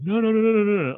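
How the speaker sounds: high-pitched string of denials; retold protest. Friend insisting he isn't playing her, high mock protest.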